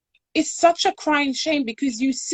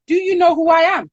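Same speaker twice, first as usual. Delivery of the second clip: higher, louder, fast imitation of an indignant woman; retold indignant outburst; 7 semitones higher, 6 dB louder, faster than usual. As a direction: throw it back at her, affronted and quick. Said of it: "she just turned around and she said".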